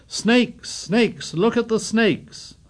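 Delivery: high, mild mock scream. A narrator's reading. Little boys screaming at the burning creepers, high. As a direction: cry out in terror. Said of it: "The little boys screamed".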